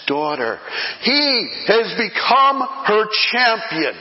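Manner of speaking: full-force preacher's climax; preaching at full force. Acted. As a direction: preach it out to the congregation, building.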